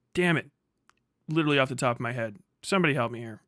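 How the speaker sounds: ordinary talk, nothing marked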